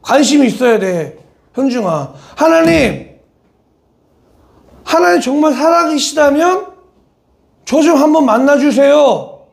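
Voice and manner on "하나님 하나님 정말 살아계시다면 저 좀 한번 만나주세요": earnest prayer. Shown as a demonstration. Preacher showing the congregation how to pray, earnest plea. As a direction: plead sincerely to God. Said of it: "그 기도가 반드시 있어야 돼요".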